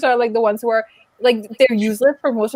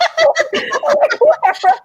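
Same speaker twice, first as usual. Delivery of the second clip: a sudden high burst of laughter; 13 semitones higher than usual. Real, not acted. Laughing at the character's flashlight blunder, bursting into high laughter. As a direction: crack up at how dumb the scene was.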